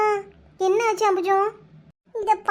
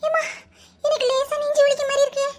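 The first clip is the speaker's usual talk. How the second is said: pained gasp; 6 semitones higher than usual. Acted. Shocked woman feels chest pain.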